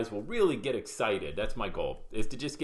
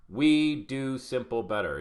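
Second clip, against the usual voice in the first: "We do simple better" drawn out word by word; slow, emphatic; 3 dB louder, slower than usual. Real, not acted. Coach restates the group's motto for his students, slow and emphatic. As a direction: hammer the motto home slowly.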